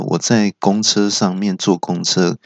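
ordinary delivery

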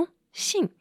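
sudden high questioning lilt on the last word; a baffled, rising "a letter?"; puzzled surprise. Retold or imitated. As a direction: caught off guard by the news. Puzzled at partner's sudden change.

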